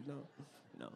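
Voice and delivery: ordinary talk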